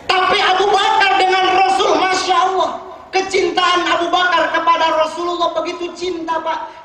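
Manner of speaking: high, loud preacher's cry of devotion; fervent preaching. Acted. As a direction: proclaim it to the hall with full force. Preacher raises voice about love. (berkhotbah dengan suara tinggi dan lantang)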